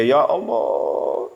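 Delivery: long drawn-out, mock-tearful cry; lightly acted wail, very slow; acted weeping. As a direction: stretch it out as a tearful lament. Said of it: "Akhirnya nangis dia".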